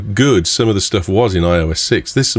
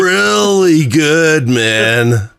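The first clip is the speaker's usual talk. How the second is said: very slow, higher surfer-dude stretch on really good; over-emphasize every word like a cartoon turtle; Ninja Turtle emphasis; 5 semitones higher, much slower than usual. Mocking the CEO's coached keynote, slow Ninja Turtle drawl. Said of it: "He sounds like one of the Ninja Turtles".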